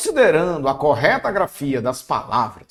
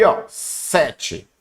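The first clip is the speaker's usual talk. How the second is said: usual speaking voice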